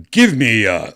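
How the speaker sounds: loud sudden demand, then trailing; urgent command. Retold or imitated. Doctor on the plane calling for gear, loud urgent command. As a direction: snap the order out, then stall. Doctor barking orders again.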